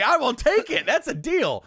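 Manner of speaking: high, loud and eager acceptance; eager kid. Retold or imitated. Kid accepting dad's hair deal, high and eager. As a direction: grab the deal like a thrilled boy.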